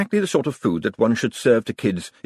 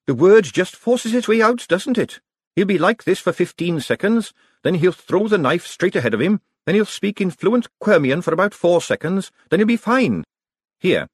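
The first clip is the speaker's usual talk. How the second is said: put-on a girl's voice, raised in pitch, brisk and practical; a kitchen girl handling a familiar crisis, higher; young servant girl, matter-of-fact, higher; lightly voiced young girl; 10 semitones higher, 4 dB louder than usual. A narrator's reading. Narrator voices young Peggy.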